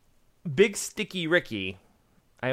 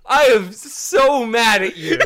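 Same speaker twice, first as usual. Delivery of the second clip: laughing mock anger; 3 semitones higher, 8 dB louder than usual. Real, not acted. Laughing fake anger at pun.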